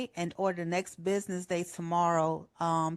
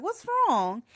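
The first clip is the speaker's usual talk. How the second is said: higher mock-mom voice, concerned; lightly voicing a worried mother; mom asking; 7 semitones higher than usual. Mom checking on her crying daughter, higher concerned voice.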